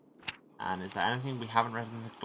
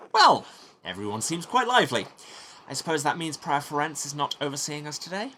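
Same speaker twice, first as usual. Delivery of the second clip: higher-pitched, emphatic entrance; lively monk character; 7 semitones higher, 5 dB louder than usual. Acted. Monk remarking on the lively room, high-pitched character voice. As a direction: break into the squabble with a high lilt.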